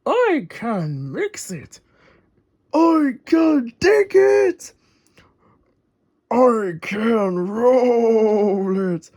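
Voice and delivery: slow rumbling machine voice. Acted. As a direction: drag each claim out slowly. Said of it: "rumbled Rolly".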